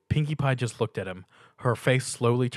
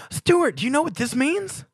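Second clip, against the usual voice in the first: much higher and louder, bursting with excitement; thrilled pony; 6 dB louder than usual. A narrator's reading. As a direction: burst out with the big news. Pinkie thrilled by the note.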